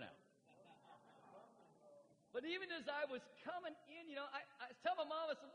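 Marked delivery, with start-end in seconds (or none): none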